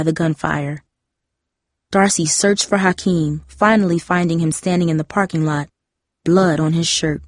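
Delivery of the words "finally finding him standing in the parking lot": plain reading voice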